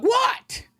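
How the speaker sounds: sharp, very high "What?"; exasperated what. Pretend. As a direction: snap a squeaky "What?" at the cat. His reply to the cat.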